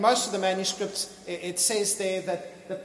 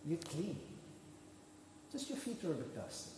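soft, low, gentle reassurance; Jesus soft and patient; 4 semitones lower, 20 dB quieter than usual. Retold or imitated. Jesus gently telling Peter he's already clean, soft and low. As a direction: quietly set Peter straight, like a calm mate. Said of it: "Jesus looks at him and goes".

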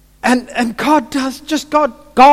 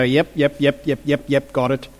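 low, quiet string of yeps; dismissive intellectual nod-along; 7 semitones lower, 6 dB quieter than usual. Acted. Mimicking someone who only remembers intellectually, low and detached. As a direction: rattle off detached, perfunctory yeps. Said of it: "easy to remember intellectually and go".

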